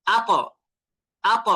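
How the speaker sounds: আক said as "at", heavy t bounced off the palate; tongue tip to palate, then push out the final sound of আক as an echoing t; pushed qalqalah. Shown as a demonstration. Teacher shows qalqalah on Ta, আক ending in a bounced heavy t. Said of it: "জিহ্বার যে আগাটা এটা তালুর সাথে লাগিয়ে এটাকে ধাক্কা দিতে হবে".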